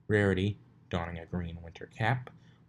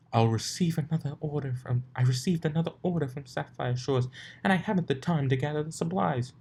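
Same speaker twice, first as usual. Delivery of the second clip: higher, hurried Rarity voice; Rarity frantic; 8 semitones higher than usual. A narrator's reading. Rarity explains her urgent order, high and frantic. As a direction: rush it out in a panic.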